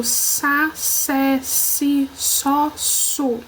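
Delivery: "sa, sé, si, só, su" one by one with a hissed S; S sound demonstrated. Shown as a demonstration. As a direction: sound out each syllable separately, starting on a clean unvoiced s. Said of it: "esse som que eu acabei de fazer". (som de S, sílaba por sílaba)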